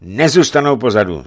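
high, loud roll-call of allies; boyar's rousing pledge; exclaiming. A narrator's reading. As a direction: call the names out keenly. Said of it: "zvolal Borecký".

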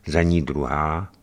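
ordinary delivery, nothing marked

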